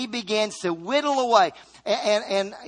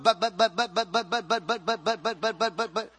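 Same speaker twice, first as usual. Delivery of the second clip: rapid repeated but, but like an engine sputtering; preacher imitating a motorboat with stammered buts; stammering motorboat; 3 dB quieter than usual. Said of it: "You ever heard of motorboat?".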